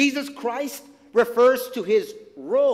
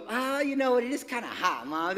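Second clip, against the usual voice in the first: put-on wheedling child; 2 semitones higher, 5 dB quieter than usual. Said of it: "turn your no into a".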